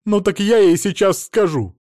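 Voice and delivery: high, loud retort; indignant threat. A narrator's reading. Mother-in-law vows to tell her daughter, indignant threat. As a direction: threaten it down the line.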